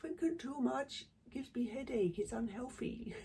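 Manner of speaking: high, mocking put-on voice; lightly mocking impression; mock quote. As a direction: parrot his excuse in a silly voice. Mocking someone's quoted excuse.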